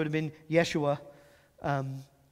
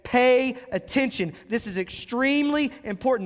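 raised, louder call for attention; emphatic; 6 semitones higher, 3 dB louder than usual. Acted. Preacher voicing the Hebrew language's emphasis, emphatic. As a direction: stress it as extremely important. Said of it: "when it wants to be emphatic".

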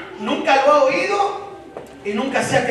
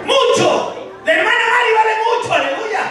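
raised, fast preaching; rally the congregation with full force; fiery preaching; 4 semitones higher than usual. Acted.

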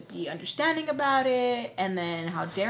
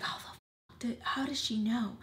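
hushed, disbelieving aside; baffled whisper; 3 semitones higher, 7 dB quieter than usual. Retold or imitated. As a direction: whisper it as if secretly caught out. Her baffled private reaction, retold.

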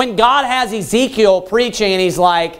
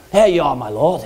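mock calm priest; 7 semitones lower than usual. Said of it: "how they just stand up real calmly".

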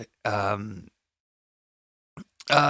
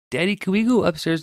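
put-on toddler asking; 8 semitones higher, faster than usual. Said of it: "she's like".

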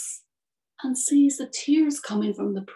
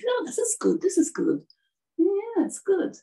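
lightly voiced swallow, higher pitch; a hint of a little bird settling in, pitched up; contented swallow; 9 semitones higher than usual.

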